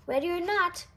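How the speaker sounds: usual speaking voice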